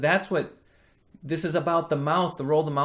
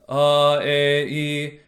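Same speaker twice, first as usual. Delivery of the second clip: lazy speaking vowels; 5 dB louder, much slower than usual. Shown as a demonstration. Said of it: "we may speak like this".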